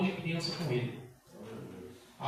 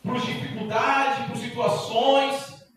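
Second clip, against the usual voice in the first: slow, louder, each reason weighed; slow emphatic; 5 semitones higher, 5 dB louder, slower than usual. Real, not acted. Preacher confessing to church, slow and weighty. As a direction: spell out the excuses slowly.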